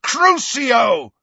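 loud, high shouted curse; full-force furious spell cry; bellow. A narrator's reading. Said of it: "and bellowed".

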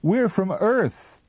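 high, loud and slow; insistent. A narrator's reading. Captain announces to the Martian, high, loud and slow. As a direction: spell it out loudly and slowly. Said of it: "The captain said".

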